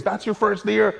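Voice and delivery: high, loud, astonished question; delighted surprise. Retold or imitated. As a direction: ask it amazed and thrilled. Chris surprised it's his first.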